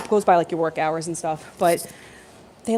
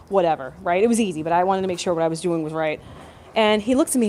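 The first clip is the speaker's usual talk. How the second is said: ordinary talk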